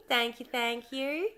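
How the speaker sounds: normal talking voice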